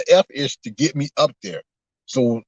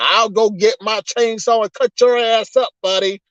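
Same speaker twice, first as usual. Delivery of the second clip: mock yelling; 8 semitones higher than usual. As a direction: imitate Billy Joe Bob ranting and threatening. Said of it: "yelling and screaming and talking about".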